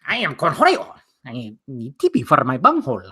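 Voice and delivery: Beavis as Cornholio, strained cartoon voice. Doing Cornholio for viewers, a strained cartoon voice. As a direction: ham up the Cornholio demand. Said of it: "I can do a Beavis impression".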